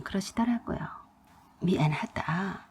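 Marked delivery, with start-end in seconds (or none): 1.6-2.6 slow, soft, lower and subdued; quiet apology. Retold or imitated. Dying mother-in-law apologizing to her daughter-in-law, slow and soft. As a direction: say sorry quietly, like someone near death.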